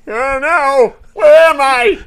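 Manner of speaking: high, shouted mock panic; hammed-up bewildered wail; silly cartoon Superman. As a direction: yell it like a dazed cartoon hero. Voicing Superman waking from the dead.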